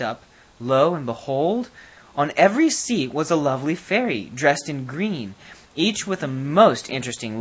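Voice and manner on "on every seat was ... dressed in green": usual speaking voice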